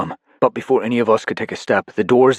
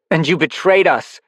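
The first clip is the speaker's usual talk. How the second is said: higher, louder accusing; cold anger; 9 semitones higher, 5 dB louder than usual. Acted. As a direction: spit the accusation. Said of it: "none of the warmth".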